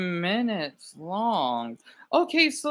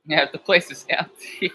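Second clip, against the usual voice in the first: ordinary talk, nothing marked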